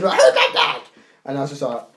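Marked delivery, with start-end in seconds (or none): 0.2-0.8 retold scream. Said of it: "she screamed".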